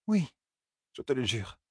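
reassuring Moiraine. A narrator's reading. Moiraine swears to Rand his friends live, very soft. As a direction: gently promise him.

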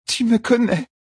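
high anguished woman's voice; insist, high and pained; under torture. A narrator's reading. Tortured woman pleads with Rand.

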